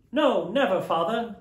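put-on children vowing to their father; children crying out. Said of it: "they all cried again".

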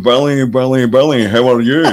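impersonated film star on the phone; Sean Connery. Retold or imitated. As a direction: put on a famous actor's greeting voice. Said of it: "And this voice went".

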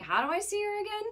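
high-pitched imitation of a puzzled fan; mock listener question. Host mimicking a listener hearing the tour cities, mock puzzled question.

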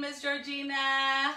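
ordinary delivery

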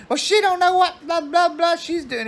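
very high-pitched mock hollering; screaming and hollering. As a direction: imitate a screaming squabble, words dissolving into blah. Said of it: "carry on and scream and holler".

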